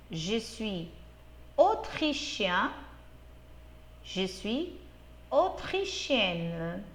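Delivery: teacher models each word slowly for the class; slow model repetition. Shown as a demonstration. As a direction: say each form slowly, clearly, pausing for repetition. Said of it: "eu vou repetir esses termos". (professora repetindo devagar para os alunos repetirem)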